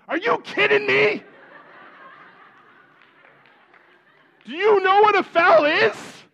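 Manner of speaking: high, loud yell at the ref; wife loses it at the referee, yelling high and loud. Retold or imitated. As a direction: shriek it out like a furious sports mom. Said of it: "out of my wife's mouth".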